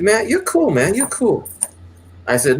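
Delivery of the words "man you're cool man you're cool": much higher, smooth admiring voice; imitating the pimp. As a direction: play the pimp, impressed and easy. Voicing the pimp's approval.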